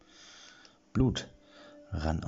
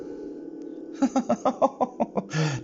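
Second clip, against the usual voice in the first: high witch cackle. Acted.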